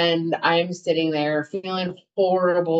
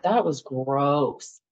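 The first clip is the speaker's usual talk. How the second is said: "That was gross" slow and lower; emphatic disgust; 4 semitones lower, much slower than usual. Retold or imitated. Woman vowing never again after wedding, low slow disgust on "gross". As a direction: draw out "gross" low and final.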